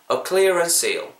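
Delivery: slow "A clearance sale", pitch high on "clearance"; weak "A", then "clearance sale" joined at the S; model pronunciation. Shown as a demonstration. Teacher wraps up the tip, "A clearance sale" slow and linked. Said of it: "까지 발음이 될 수 있다라는 거".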